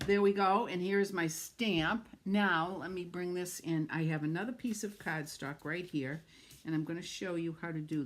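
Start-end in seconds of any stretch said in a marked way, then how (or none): none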